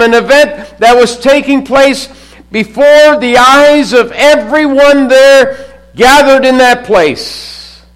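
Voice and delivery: loud, high pulpit build; a fired-up preacher driving the point home; preaching at full force. Acted. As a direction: preach it with rising fervour. Preaching climax.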